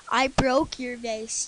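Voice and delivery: loud slow shout, drawn out; yelled. A narrator's reading. Boy yelling his confession to Mom. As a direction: yell the confession at full volume. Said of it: "yelled Jack".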